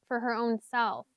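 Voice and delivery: normal talking voice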